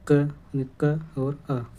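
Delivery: क split very slowly into क और अ; slow sound breakdown. Shown as a demonstration. Teacher to pupils, splitting क into its sounds, very slow. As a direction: spell out क as its parts, slowly. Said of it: "प्रत्येक व्यंजन में अ की धुन समाहित होती है".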